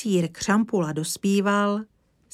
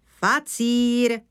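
higher, louder snapped word; barked correction; 6 semitones higher, 6 dB louder than usual. A narrator's reading. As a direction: bark out the correct name. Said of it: "houkl Křampula". (houknutí, důrazná oprava)

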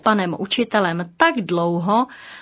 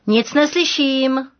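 booming call; 5 semitones higher, slower than usual. Acted. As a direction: boom it out to the timid pupil. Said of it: "houkl silným hlasem pan učitel".

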